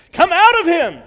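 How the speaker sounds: sudden booming, high-pitched command; mock exorcism shout. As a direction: bellow it like casting out a demon. Mock exorcism command in toy aisle.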